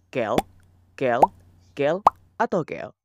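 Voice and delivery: every "quel" said identically as "kel"; all pronounced the same. Shown as a demonstration. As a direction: say each "quel" alike, one short syllable. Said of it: "Semuanya dibaca sama".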